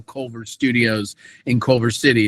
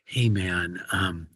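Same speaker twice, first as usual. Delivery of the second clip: low, lightly voiced animator; a hint of a nervous fan; animator's voice; 5 semitones lower than usual. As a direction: animator approaching him in a coffee shop, lowered voice.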